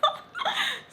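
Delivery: high laugh at own slip. Real, not acted. Teller laughing after saying the name, amused.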